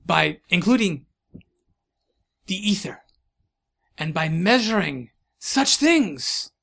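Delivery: halting lecture, pitch swooping on key words; accented eccentric scientist. Acted. Scientist revealing weather theory, German-French accent.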